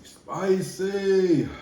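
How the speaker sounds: spicy cried high and loud, falling; loud exclaim. Real, not acted. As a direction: yell spicy out at the burn.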